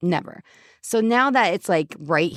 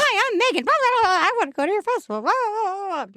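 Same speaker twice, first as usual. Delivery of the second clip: high-pitched parody of pitching yourself; mock self-pitch. Guest pitching herself to festivals, high mock spiel. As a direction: squeal out a silly networking spiel.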